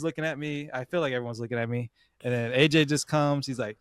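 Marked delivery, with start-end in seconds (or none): none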